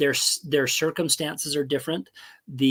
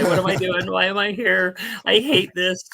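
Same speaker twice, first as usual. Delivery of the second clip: mock despair; 5 semitones higher, 3 dB louder than usual. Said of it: "I wanted to cry".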